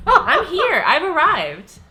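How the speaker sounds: gleeful shout of triumph; excited. Real, not acted. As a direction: crow it out, laughing. Gloating about being in heaven.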